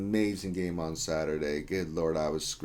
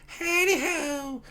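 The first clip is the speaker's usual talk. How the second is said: very high, slow, laughing howdy; sing-song greeting; much slower than usual. Pretend. Streamer reading a chat greeting aloud, high and slow. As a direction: stretch it up high and giggle it out.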